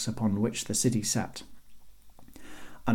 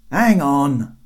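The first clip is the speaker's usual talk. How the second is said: loud, somewhat raised hold-on; called-out aside; 4 semitones higher, 7 dB louder than usual. A narrator's reading. Guard leaving to fetch others, calling down. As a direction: call it down from the wall. Guard calls down before disappearing.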